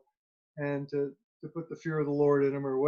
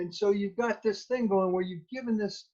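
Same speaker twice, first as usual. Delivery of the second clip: ordinary delivery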